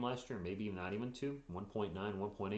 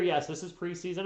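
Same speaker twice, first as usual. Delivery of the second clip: normal talking voice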